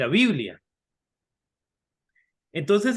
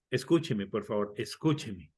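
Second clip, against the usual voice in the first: lower, softer pleading plea; 9 semitones lower, 7 dB quieter than usual. Retold or imitated. Asking people to listen, soft lower pleading. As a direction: beg quietly to be heard. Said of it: "Tenemos que decirle a la gente".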